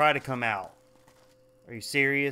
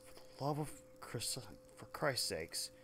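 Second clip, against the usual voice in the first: weary mutter; 3 semitones lower, 10 dB quieter than usual. Real, not acted. Muttering after finishing.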